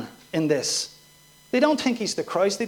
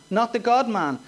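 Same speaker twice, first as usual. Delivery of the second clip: normal talking voice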